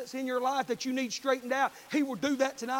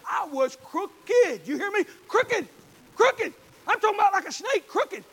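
high, loud, slow CROOKED punched out; crooked hammered; 7 semitones higher, 7 dB louder than usual. Acted. Preacher confessing his past to the congregation, hammering crooked. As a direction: hit "crooked" hard each time.

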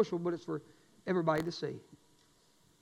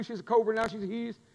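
normal talking voice

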